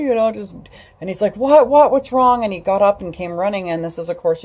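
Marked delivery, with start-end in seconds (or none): 1.4-2.4 high, loud alarmed what; startled, asking what happened; alarmed husband. Retold or imitated. Husband in the living room answering her call, alarmed.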